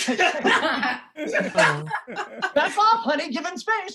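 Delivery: imitating the wife telling him to back off; wife snapping at husband to back off, mock nag; nagging wife. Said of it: "starts imitating my wife".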